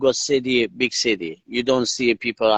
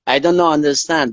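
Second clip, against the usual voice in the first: ordinary talk, nothing marked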